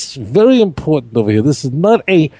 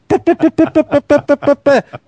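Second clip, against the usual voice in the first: mock giggle; 11 semitones higher than usual. Mock gossip laugh.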